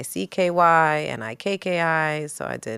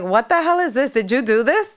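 much higher and louder, stunned outburst; shocked disbelief; 9 semitones higher, 6 dB louder than usual. Retold or imitated. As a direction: retell the moment the news hit. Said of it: "I was like".

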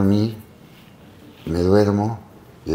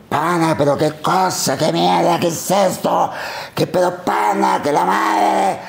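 much higher, frantic shouting; panicked yelling; 10 semitones higher, slower than usual. Retold or imitated. Actor's brother screaming at an intruder, panicked shouts.

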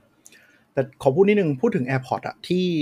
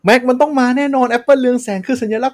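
mock fanboy outcry, much higher and louder; clamoring Mac fans; 11 semitones higher, 5 dB louder than usual. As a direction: insist like a fan demanding the Mac. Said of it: "ไอ้พวกกลุ่ม Mac ก็โวยวายว่า".

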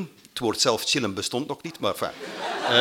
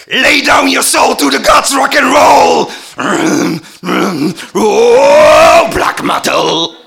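screamed metal chant, higher and much louder; 11 semitones higher, 12 dB louder, much slower than usual. Retold or imitated. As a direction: roar it like the end of a metal song. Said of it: "Het klinkt zo".

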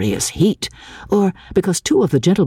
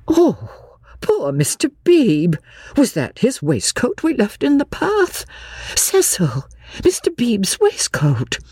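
dismayed woman; 9 semitones higher than usual. A narrator's reading. Lucy dismayed.

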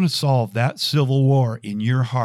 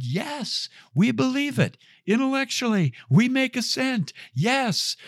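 very high, held-back cheering voice; mock crowd yell; 13 semitones higher than usual. Crowd assures the tightrope walker they believe, mock yell. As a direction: parody an eager crowd chanting up high. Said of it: "they yelled out".

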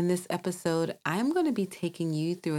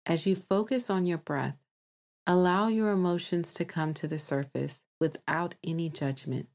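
calm meditation voice with long pauses; guided meditation. Acted. Host guides listeners to release emotions, calm meditation voice. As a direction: guide them gently, leaving space to breathe.

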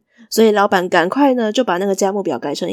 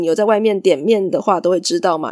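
plain reading voice

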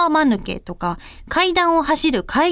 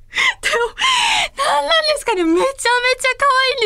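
gushing excitement; 10 semitones higher than usual. Real, not acted. Gushing over cute co-hosts.

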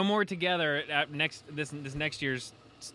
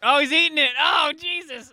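high, loud gasp of disbelief; shocked disgust; 11 semitones higher, 9 dB louder than usual. Real, not acted. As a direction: recoil as he digs in. Watching friend eat gross smoothie.

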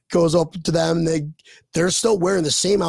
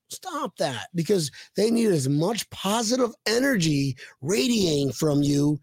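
ordinary talk

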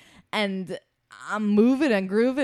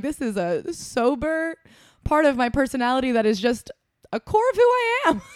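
mock proud declaration; 6 semitones higher, 3 dB louder than usual. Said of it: "And I'm like".

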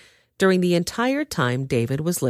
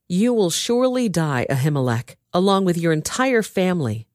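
ordinary delivery, nothing marked

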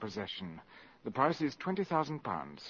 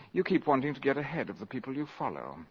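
normal talking voice

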